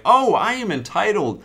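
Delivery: high, whiny mock of an entitled viewer; lightly voiced entitled brat. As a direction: ham up a spoiled viewer. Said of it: "because they're like".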